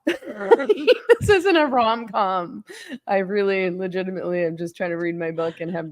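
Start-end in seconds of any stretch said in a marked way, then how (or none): 0.0-2.6 slow, laughing, pitched up. Real, not acted. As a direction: laugh it out, drawn out. Laughing at the rom-com idea.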